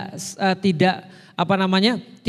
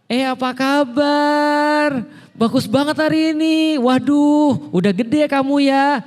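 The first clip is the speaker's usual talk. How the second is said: high, slow, sing-song greeting; cheerful father at home; 7 semitones higher, slower than usual. Retold or imitated. Father home from work greeting his child, much higher. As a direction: beam at the kids in a bright fatherly voice. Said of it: "suaranya juga sudah beda".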